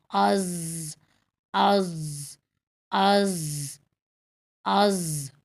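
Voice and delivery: از ending on a held heavy ض buzz; sakin Daad. Shown as a demonstration. Teacher tests the articulation point, از with a sustained final buzz. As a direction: open از, then hold the emphatic consonant vowelless. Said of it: "اور پھر آپ نے ساکن کیا".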